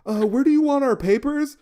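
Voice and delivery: high, loud sing-song mimic of a teacher's pet; mocking eager student. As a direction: ham up the know-it-all, high and loud. Classmate voiced in a story.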